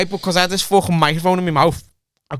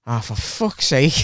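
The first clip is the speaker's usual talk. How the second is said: exasperated doctor; 3 semitones lower, slower than usual. Retold or imitated. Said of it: "she went".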